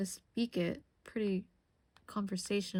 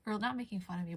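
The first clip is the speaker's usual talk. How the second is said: normal talking voice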